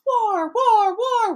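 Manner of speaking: high, loud screamed war cry; screaming. A narrator's reading. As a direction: scream it in a frenzy. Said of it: "all he was screaming was".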